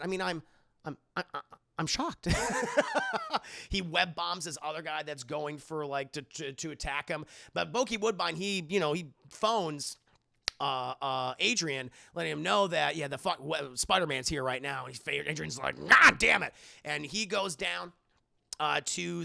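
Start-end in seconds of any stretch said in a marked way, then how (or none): 2.3-3.6 high, squealing laugh; helpless high-pitched giggle; real laugh. Real, not acted. Laughing at his own joke. 15.9-16.5 loud, frustrated acted shout; furious mock yell; angry outburst. Vulture's henchman reports Spider-Man.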